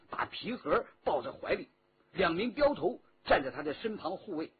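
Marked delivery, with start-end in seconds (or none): none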